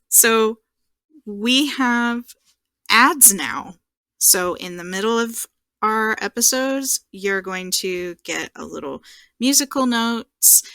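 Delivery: ordinary delivery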